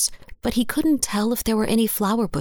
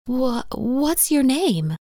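higher, slow, stammered question; hesitant woman, pitched up; slightly shaken; 6 semitones higher, slower than usual. A narrator's reading. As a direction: falter on the first word, a little shaken. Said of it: "Her voice shook slightly".

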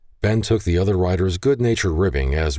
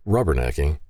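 ordinary delivery, nothing marked

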